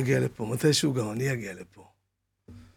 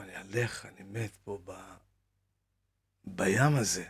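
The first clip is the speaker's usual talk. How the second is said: hushed dying words; 6 semitones lower, 9 dB quieter than usual. Retold or imitated. Drowning man's last words.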